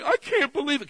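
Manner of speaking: high, loud, overjoyed cry of disbelief; excited kid. Retold or imitated. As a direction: burst out like a thrilled child. Kids crying over a gift.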